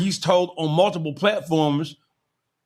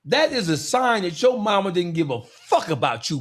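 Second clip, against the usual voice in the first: usual speaking voice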